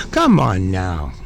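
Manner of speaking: a deep, slow, fed-up come on now; exasperated groan. Real, not acted. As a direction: come on now dropped very low and slowed. Drawn out, much lower repeat.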